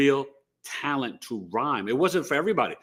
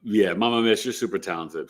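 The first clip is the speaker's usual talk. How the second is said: normal talking voice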